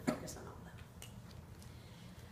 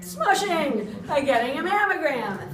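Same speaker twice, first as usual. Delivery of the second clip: excited echo; 7 semitones higher, 10 dB louder, slower than usual. Real, not acted. Echoing audience answer, delighted.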